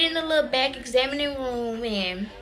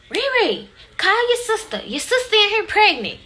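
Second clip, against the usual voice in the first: high, loud call to the other room; imitating mama hollering for the sisters with the news; 10 semitones higher, 4 dB louder than usual. Mama shouting the news to her daughters, high and loud.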